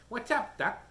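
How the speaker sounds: lightly voiced cocky rabbit; Bugs Bunny. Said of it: "Bugs Bunny?".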